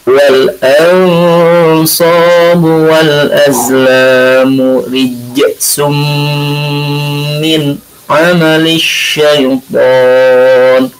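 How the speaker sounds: slow, melodic chanted recitation; measured, devotional tajwid recitation; Quran recitation. Acted. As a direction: chant the verse slowly with long held vowels. Recites the verse.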